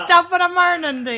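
put-on high, loud stage Irish accent through laughter. Irish guest mocking her own accent when asked, laughing. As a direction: ham up a stock Irish greeting. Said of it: "I don't actually sound like that".

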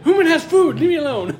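high, loud voiced pet dog. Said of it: "He's like".

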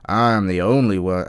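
put-on loud, dumb American accent; mock generic American moron accent. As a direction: play a loud, clueless American politician. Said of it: "generic American moron accent".

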